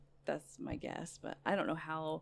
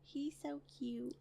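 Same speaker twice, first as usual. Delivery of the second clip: soft whisper, higher and slow; 6 semitones higher, 11 dB quieter, slower than usual. Real, not acted. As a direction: whisper fondly, almost breathless. Whispering about the dog.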